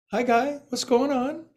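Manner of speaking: put-on squeaky baby talk greeting; high, sweet talking to a pet; cute high voice. Greeting own foot in cute voice.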